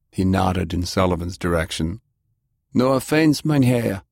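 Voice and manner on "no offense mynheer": put-on higher-pitched German-accented voice. A narrator's reading. Stranger tells Delilah of his rescue, German accent. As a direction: state it with a firm German accent. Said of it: "he stated with a German accent".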